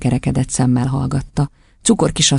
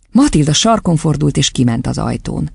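ordinary delivery

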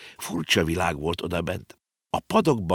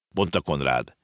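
ordinary delivery, nothing marked

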